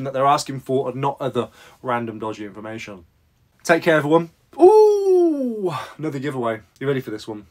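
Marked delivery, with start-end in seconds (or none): 4.6-5.7 excited ooh. Pretend. Excited about new giveaway.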